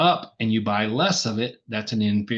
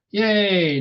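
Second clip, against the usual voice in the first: cheer; 10 semitones higher, 6 dB louder than usual. Real, not acted. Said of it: "I got it right".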